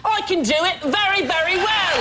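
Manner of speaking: very high, hammed-up mock Cockney; overdone London accent, squeaky high; bad London accent. Shown as a demonstration.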